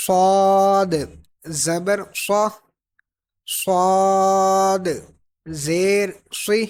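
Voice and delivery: صاد held long with thick S and bounced D; heavy whistled S in صاد and صا, D echoing; full, whistling, echoing. Shown as a demonstration. Teacher drilling after the rule, full echoing صاد. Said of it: "اس کو پر بھی پڑھنا ہے".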